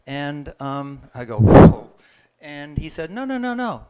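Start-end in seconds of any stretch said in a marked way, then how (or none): 1.4-1.9 very loud, startled whoa; a friend's news hits, a shouted whoa. Retold or imitated. Reacting to lost eyesight. 3.1-3.8 high, rapid no no no; a friend cutting in, high and insistent; insistent no's. Retold or imitated. Friend insists it's okay.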